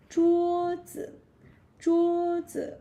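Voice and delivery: 桌子 with 桌 high, level and held; first tone. Shown as a demonstration. Teacher giving the word for table, 桌 in high flat tone. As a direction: hold 桌 flat and high, then 子. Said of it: "which is first tone, flat tone".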